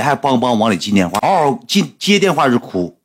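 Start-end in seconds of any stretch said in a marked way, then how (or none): none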